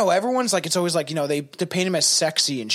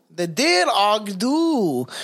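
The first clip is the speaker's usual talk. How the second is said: slow, drawn-out name; 2 semitones higher, much slower than usual. Real, not acted. Retries a tricky name slowly.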